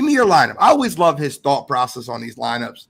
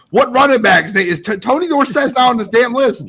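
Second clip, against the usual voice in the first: higher, louder, outraged inner voice; mock indignation; 7 semitones higher, 3 dB louder than usual. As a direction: act baffled at the list. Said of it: "I'm sitting there thinking".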